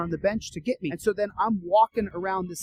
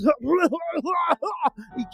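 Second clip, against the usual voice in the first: high gurgling gasps for air; long mock choking noise; imitated choking; 11 semitones higher, 3 dB louder than usual. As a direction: mimic someone choking, unable to breathe. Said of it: "can't get any air into his lungs".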